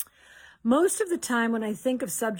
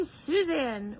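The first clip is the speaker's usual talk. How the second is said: normal talking voice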